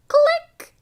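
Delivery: bright surprise sound; excited click. A narrator's reading. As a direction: burst it out like a switch flipping. Plugging in nighty light.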